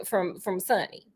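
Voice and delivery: usual speaking voice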